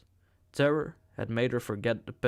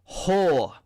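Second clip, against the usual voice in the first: enraged, louder and higher; furious shout; 7 semitones higher, 7 dB louder than usual. A narrator's reading. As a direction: spit the word out in fury. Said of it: "he shouted".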